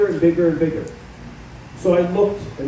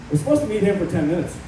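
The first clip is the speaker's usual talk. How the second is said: ordinary delivery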